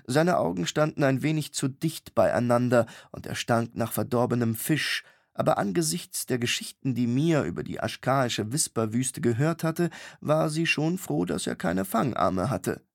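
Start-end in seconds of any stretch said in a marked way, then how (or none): none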